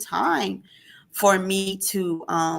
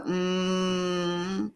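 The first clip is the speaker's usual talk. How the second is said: imitated tinnitus hum; 5 dB quieter than usual. Shown as a demonstration. Showing her sister the ringing in her ears, soft steady hum. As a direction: hold a quiet ringing tone on a hum. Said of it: "sometimes it's like a".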